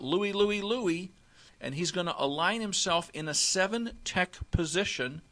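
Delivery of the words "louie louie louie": mild demo shout of the call; yell out. Shown as a demonstration. Linebacker calling strength left, mild shout. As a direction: call it across the line. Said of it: "is gonna yell out".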